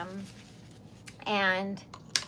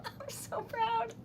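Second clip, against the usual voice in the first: choked-up pride; 13 semitones higher, 8 dB quieter, slower than usual. Real, not acted. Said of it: "I'm gonna cry".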